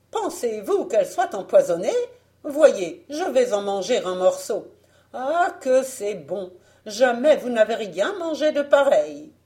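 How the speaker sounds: high, loud, animated persuasion; tempting false peasant. Acted. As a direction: tempt them with exaggerated relish. Fausse paysanne tente Blanche.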